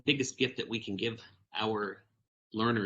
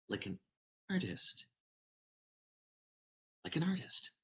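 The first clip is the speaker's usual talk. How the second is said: much softer, gentle repeat; soft affirming echo; 4 semitones higher, 9 dB quieter than usual. Acted. Vashti reassuring the nervous boy, soft gentle echo. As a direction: hand the words back to him softly.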